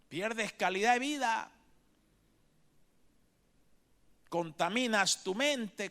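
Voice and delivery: a preacher warning about fear; impassioned preaching. Acted. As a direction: urge the church with rising fervour. Preaching exhortation resumes.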